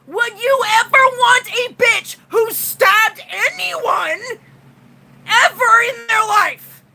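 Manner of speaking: high, strained shouting at men; real angry outburst, voice cracking; furious rant. Real, not acted. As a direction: yell it out, fed up and furious. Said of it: "I'm really pissed off".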